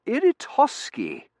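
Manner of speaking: very high, slow correction; high-pitched, plaintive correction; correcting wife. A narrator's reading. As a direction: say "Iritosky" drawn out in a high invalid voice. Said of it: "Missus Mearing corrected".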